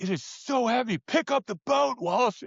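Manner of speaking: high-pitched mock yell at a teammate; strained, high shout without full volume; retold yelling. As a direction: imitate yourself yelling over your shoulder mid-row. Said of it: "I was yelling at you".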